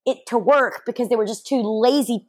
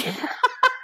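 high laugh; 4 dB quieter than usual. Real, not acted. Laugh over bathing suit.

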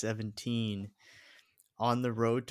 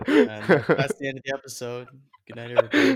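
mock sign-off; 4 semitones higher, 12 dB louder, faster than usual. Joking fake sign-off after honks.

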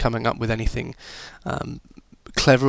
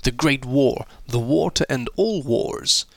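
put-on American accent. Teacher briefing pupils on their coursework mission, put-on American accent. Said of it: "American accent there".

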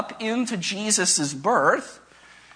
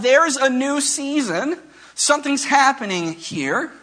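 higher, animated proclamation; mild mock scream; 6 semitones higher than usual. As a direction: announce it as the calendar's mock outcry, pitched up. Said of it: "The church calendar is screaming out".

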